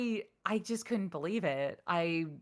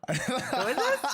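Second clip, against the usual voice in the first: asking through laughter; laughing; 7 semitones higher, 4 dB louder, slower than usual. Real, not acted. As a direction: laugh at your own confusion. Laughing at her own mix-up.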